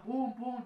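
high, punchy mock impacts; imitated thuds. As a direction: bang them out like rocks on the truck. Imitating stones hitting truck.

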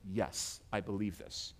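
very low, soft confession; low and barely voiced; quiet assent. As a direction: say it hushed, as a private affirmation. Voicing a quiet confession of faith.

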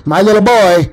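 high, loud doting parody; mock coddling mother. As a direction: fuss loudly over a precious child.

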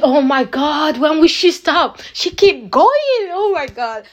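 rising to a high, drawn-out "going"; amazed exclamation. Retold or imitated. Daughter marvelling that mom never stops, amazed exclamation. Exclaiming at mom's relentless persistence.

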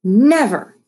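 loud, emphatic refusal; angry retort. A narrator's reading. Caitlin ending friendship at the door, angry. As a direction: throw it back angrily. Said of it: "came the angry retort".

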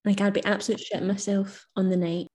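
usual speaking voice

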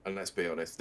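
normal talking voice

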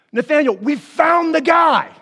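loud, high, excited call; excited announcement. Retold or imitated. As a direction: call out the big news to a friend. Disciples announce their exciting find.

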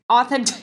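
mock the word authentic; air quotes. Real, not acted. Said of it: "I'm doing that with air quotes".

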